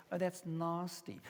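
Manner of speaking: soft, slow British "nasty" with a long AH; British or South African accent. Shown as a demonstration. Speaker contrasting how England says it, soft British accent. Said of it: "If you're from South Africa, England, or Britain".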